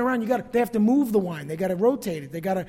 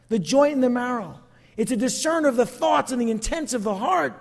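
preaching at full force; 3 semitones higher, 6 dB louder than usual. Acted. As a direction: proclaim it to the congregation like a revival preacher. Peak of sermon, full force.

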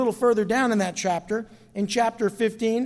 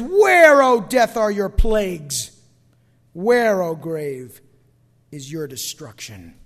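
mocking death; 4 semitones lower, 6 dB louder, slower than usual. Preacher voicing God taunting death, mocking. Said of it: "capture the essence of that mocking".

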